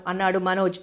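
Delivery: usual speaking voice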